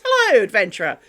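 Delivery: loud, cheery NPC hello; mock quest giver calling out a welcome; quest giver greeting. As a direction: hail the adventurer like a game NPC. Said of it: "a little thing ... goes".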